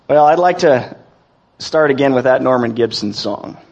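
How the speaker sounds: ordinary talk, nothing marked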